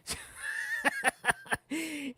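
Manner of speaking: sudden high laugh-cry; laughing outburst. Real, not acted. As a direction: burst into a pained laugh. Laughing at his own suffering. (risa aguda repentina)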